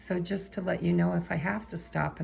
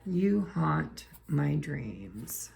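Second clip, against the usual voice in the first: slow haunting read; much slower than usual. Acted. Reading the card slowly.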